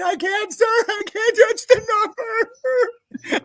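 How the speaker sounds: very high, slow, crying shout of a scared recruit; panicked whimper. Retold or imitated. Terrified recruit crying back at the screaming sergeant, high panicked wail. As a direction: squeal it out near tears.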